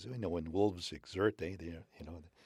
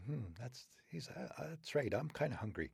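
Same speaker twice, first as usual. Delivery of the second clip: soft, higher musing to himself; wolverine musing; 5 semitones higher, 8 dB quieter than usual. Acted. Wolverine pondering the offer, soft musing voice. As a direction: think it over quietly.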